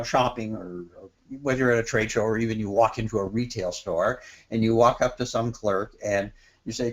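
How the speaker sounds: usual speaking voice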